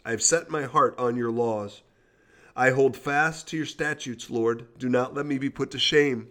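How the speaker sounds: measured devotional Bible reading; scripture reading. Acted. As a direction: read the psalm steadily. Reads Psalm 119 aloud.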